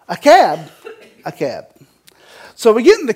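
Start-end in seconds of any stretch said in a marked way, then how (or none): 0.1-0.7 incredulous echo. Acted. Storyteller repeating his cab decision for laughs, mock incredulous. Acts out disbelief at cab ride. 1.2-1.7 lower, softer falling statement; deadpan confirmation. Acted. Storyteller confirming the cab decision for laughs, deadpan.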